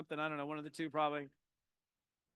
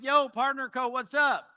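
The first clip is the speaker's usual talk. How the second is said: loud, higher greeting across the airport; hearty call-out; 6 semitones higher, 7 dB louder than usual. Retold or imitated. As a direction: hail a fellow networker from afar. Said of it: "I'm like".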